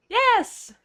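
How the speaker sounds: excited high yes. Real, not acted. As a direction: squeal it with joy. Excited at Toby Carvery offer.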